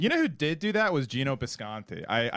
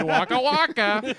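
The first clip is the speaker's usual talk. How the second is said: much higher, slow, louder waka waka; Fozzie clown; 9 semitones higher, 5 dB louder, slower than usual. Acted. Host plays a clown at a eulogy. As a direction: deliver it like a hammy puppet comic.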